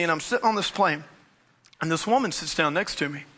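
usual speaking voice

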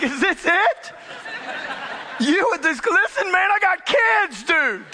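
rising to a panicky high shout; alarmed protest. Retold or imitated. Pleading with the man that he has kids, alarmed protest. Acted protest, frightened father in retold story.

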